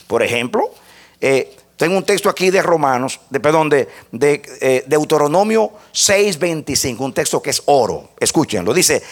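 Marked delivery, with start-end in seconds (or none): none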